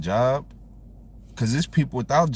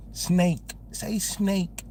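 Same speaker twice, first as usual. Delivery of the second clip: teacher voice raised higher, saying "Snake" slowly and clearly; mock teacher; 6 semitones higher, 4 dB quieter, much slower than usual. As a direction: imitate a teacher drilling "snake". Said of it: "Try to make me slow down".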